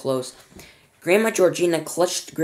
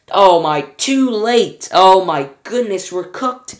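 dismayed; 4 semitones higher, 8 dB louder, slower than usual. Acted. Wonka realises they are too late.

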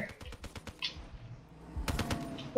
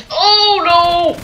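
shocked cry; 8 semitones higher, 5 dB louder than usual. Real, not acted. Enemy kill streak incoming, shocked.

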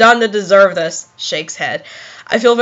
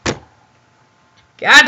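ordinary delivery

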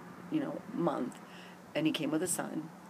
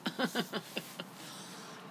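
airless chuckle, softer; soft breathy laugh; 5 semitones higher, 6 dB quieter than usual. Real, not acted. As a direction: let out a quiet breathy laugh. Laughs mid-story outdoors.

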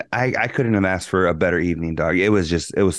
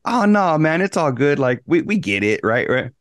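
high, reassuring friend voice; mock soothing buddy, pitched up; buddy comforting; 5 semitones higher than usual. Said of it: "I'm expecting my buddy to be like".

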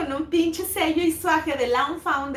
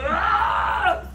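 exasperated gasp; 2 semitones higher than usual. Retold or imitated. Exasperated breathy outburst.

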